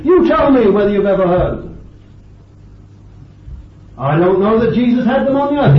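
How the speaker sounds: high, loud, ringing challenge to the congregation; impassioned preaching. Real, not acted. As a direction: preach it at full force, pitched right up. Preaches at full force.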